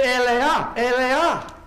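high, shouted call; loud cry. Shown as a demonstration. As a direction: shout it out as if misheard from afar. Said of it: "I wonder how loud he said those words".

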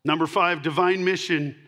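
usual speaking voice

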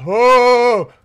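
high, loud frightened no-no-no; panicked. Real, not acted. Gamer panicking as the lights cut out. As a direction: beg the game to stop.

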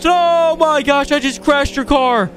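high, loud yelp of shock; stunned high-pitched cry; shocked panic. Real, not acted. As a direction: gasp out the disaster in a high voice. Crashes the borrowed Lamborghini.

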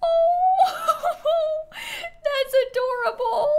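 high, slow laughing through tears; moved almost to tears; real teary laugh over a cute build; touched laugh-cry. Real, not acted. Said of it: "My inner child just shed a tear".

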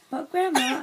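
loud, exclaimed protest. A narrator's reading. Grandchild objecting to Grandma about the trolley, loud protest. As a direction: object loudly, stressing the appeal.

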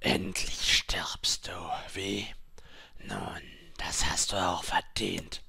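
slow, whispered gloating; sinister inner voice. A narrator's reading. Childhood trauma voice gloating at the dying woman, slow sinister whisper. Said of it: "eine Stimme aus den Tiefen ihres Verstandes".

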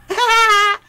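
high, loud burst of laughter. Acted. Laughing at a crude joke, high burst. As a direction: cackle loudly at your own jibe.

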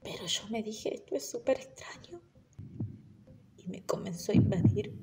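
hushed unvoiced whisper. Girl noticing something wrong, whispered. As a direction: breathe it like a secret thought. Inner thought, creeping fear.

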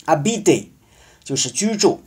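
ordinary talk

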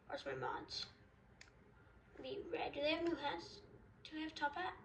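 normal talking voice

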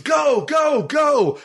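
high-pitched imitated urgent yell; retold yelling. Crackhead running out of the house to the car, imitated urgent yelling. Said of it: "just start yelling".

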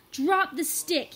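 loud, slow, firm order; commanding. A narrator's reading. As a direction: order him to stop. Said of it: "he commanded".

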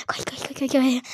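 urgent breathy whisper; rushed unvoiced whisper to run; hushed whisper. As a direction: whisper so the killer won't hear. Whispering while hiding.

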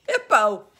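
embarrassed blurt. Acted. Rhinoceros finally reveals name.

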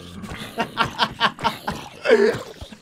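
airy disbelieving chuckle; breathy laugh. Real, not acted. Player chuckling at the tickled zombie, airy laugh. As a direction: laugh under his breath at the silly fight.

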